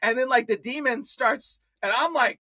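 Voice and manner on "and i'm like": usual speaking voice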